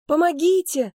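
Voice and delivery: loud, high cry for help; exclaimed plea. A narrator's reading. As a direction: cry out urgently, pitched far up. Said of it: "воскликнула я". (громкий, высокий крик о помощи)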